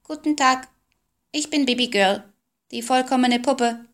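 high, squawking mechanical doll; squawky doll voice. A narrator's reading. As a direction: quack it out like a talking doll. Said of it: "die etwas quäkend klang".

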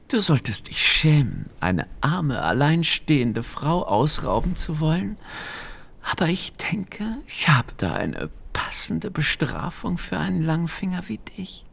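softly threatening witch, fading to whisper; menacing witch. A narrator's reading. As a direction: sink into a sly threatening whisper.

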